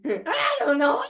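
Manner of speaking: high cartoon dog voice; laughing high Scooby-Doo yelps; Scooby and Shaggy voices. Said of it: "That was the impression".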